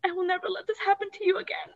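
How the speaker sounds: high, soft, holding back tears; real choked-up voice. Real, not acted. Choked up retelling mom breaking down.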